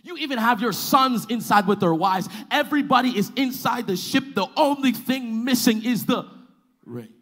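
high, loud, driving delivery; full-force preaching. Real, not acted. Preacher telling congregation what is missing, loud high-pitched preaching. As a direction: build the case urgently at full voice.

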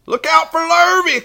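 high, loud warning call; cows crying out. A narrator's reading. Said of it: "cried the cows".

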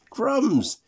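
high and loud, amazed; surprised exclamation. Acted. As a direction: blurt it out in astonishment.

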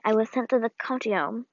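plain reading voice

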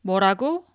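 뭐라고 with a casual rising question; casual Korean demo. Shown as a demonstration. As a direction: toss off 뭐라고 like to a close friend. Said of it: "you can say".

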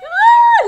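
very loud, high drawn-out "ah"; high-pitched squeal. Real, not acted. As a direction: squeal at a hilarious scene. Reacción exagerada a escena de risa. (chillido agudo y largo)

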